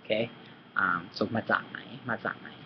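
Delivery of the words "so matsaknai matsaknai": "matsaknai" with a sharp ts in the middle, slow and soft; crisp ts sound. Shown as a demonstration. Teacher slowly modelling the Thai question "matsaknai". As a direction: land the ts of "matsaknai" cleanly. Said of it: "You wanna hear this real crisp".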